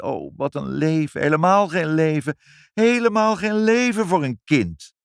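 indignant lament, climbing with each repeat; exclaimed lament. A narrator's reading. Mother deplores the rules for children, rising lament.

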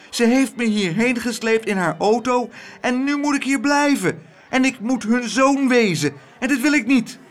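hoge, snelle jongensstem vol paniek; angstige jongen smeekt. Acted. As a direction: ratel het af als een bange jongen. Abeltje smeekt, stem van jongen. (Abeltje ratelt in één adem alles af, hoog en gejaagd)

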